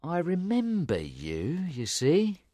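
slow, deliberate recollection; measured, knowing man's voice. A narrator's reading. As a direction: draw the words out as memory returns. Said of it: "Jerry said slowly".

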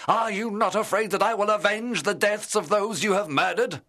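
furious challenge, rising high and loud; hatred and anger. A narrator's reading. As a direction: build to an outraged, high-pitched shout. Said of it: "I trembled with hatred and anger".

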